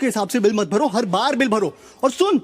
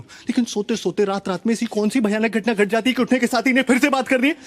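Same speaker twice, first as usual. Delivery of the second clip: usual speaking voice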